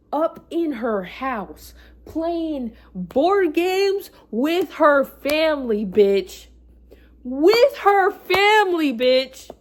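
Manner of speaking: loud, high, drawn-out shouts of disbelief; outraged yelling. Real, not acted. Telling of the best friend kissing her ex, outraged yelling. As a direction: build into real shouting, hammering each phrase. Outraged climax of betrayal story.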